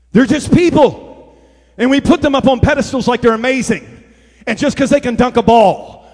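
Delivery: loud, driving preacher's shout; full-force preaching. Real, not acted.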